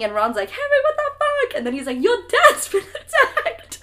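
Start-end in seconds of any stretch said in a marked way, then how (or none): 0.5-1.6 very high, loud mock freak-out; panicked yelp. Ron woken by Harry screaming from a nightmare, panicked. 2.0-3.8 high, loud, drawn-out mock scream. Harry waking from the nightmare to warn Ron, hammed-up screaming. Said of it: "he's screaming".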